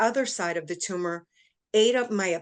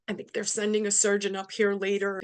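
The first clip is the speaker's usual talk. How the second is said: normal talking voice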